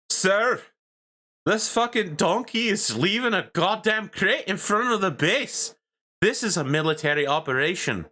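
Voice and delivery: put-on high-pitched young soldier reporting in outrage; indignant private. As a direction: complain up to a superior in a high, strained voice. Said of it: "a private third class, and he salutes".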